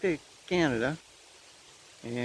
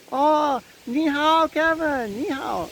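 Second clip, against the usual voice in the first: high, loud imitation of an eager hello; excited greeting; 12 semitones higher, 4 dB louder, slower than usual. Woman waving from the TV greets Kevin, imitated excitedly. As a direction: call out a delighted hello in a raised voice.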